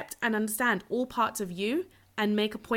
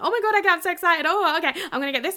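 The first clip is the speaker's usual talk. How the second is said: high, loud and bubbling, fast at the end; gushing excitement; 8 semitones higher, 6 dB louder, faster than usual. Retold or imitated. Telling listeners how she feels about Christmas, thrilled and gushing. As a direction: squeal it like a kid before Christmas. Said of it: "genuinely fucking excited".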